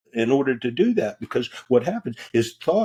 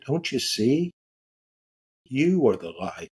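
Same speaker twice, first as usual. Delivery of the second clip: 1 lady's revelation; 2 semitones higher than usual. Retold or imitated. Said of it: "she said".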